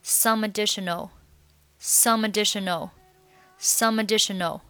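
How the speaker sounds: "some additional" joined as "su-madditional"; linked words. Shown as a demonstration. Teacher drilling linking, "some additional" run together. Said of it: "和后面的additional连".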